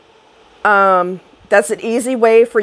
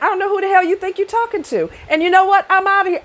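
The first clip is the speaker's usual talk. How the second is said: higher, firm, no-nonsense demo; fed-up brush-off; 8 semitones higher, faster than usual. Shown as a demonstration. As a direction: tell him off like you mean it. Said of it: "Practice saying that".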